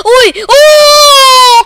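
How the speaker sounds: huge high goal scream; ecstatic shout. Real, not acted. Gamer celebrating his goal, ecstatic shout. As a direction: scream it to the roof.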